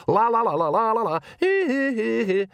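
high, frantic babbling la-la; shouted nonsense. A narrator's reading. Nanny blocks Magrat's thoughts, shouting nonsense high. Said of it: "shouted Nanny".

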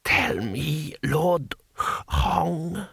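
put-on whispery, halting old ruler; ancient emperor. A narrator's reading. Emperor consulting Lord Hong, whispery ancient voice. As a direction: wheeze it out with long pauses. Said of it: "said the ancient Emperor".

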